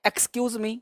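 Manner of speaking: soft polite request. Shown as a demonstration. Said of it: "या बोलना है".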